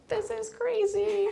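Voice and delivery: high, drawn-out comic squeak; hammed-up alarm under the breath; mock freaked-out aside. Acted reaction to people crying.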